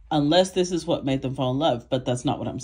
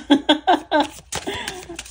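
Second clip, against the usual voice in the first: burst of laughter; 7 dB louder than usual. Real, not acted. Laughing at her own accent.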